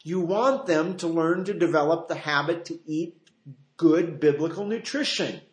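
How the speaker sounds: ordinary talk, nothing marked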